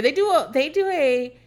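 normal talking voice

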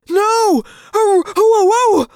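high, loud panicked cries; screaming. Acted. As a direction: scream in terror as you're bitten. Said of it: "the other boy screamed".

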